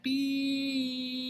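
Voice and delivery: long held B. Shown as a demonstration. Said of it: "notice I didn't say B, B, B, B".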